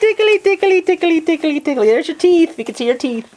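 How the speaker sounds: high squeaky singsong tickling; giggly tickle attack. Real, not acted.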